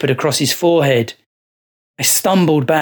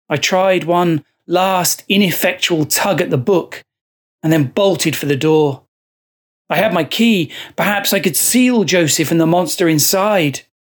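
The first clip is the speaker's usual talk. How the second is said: ordinary delivery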